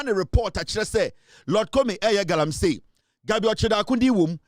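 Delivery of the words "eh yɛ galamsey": ordinary delivery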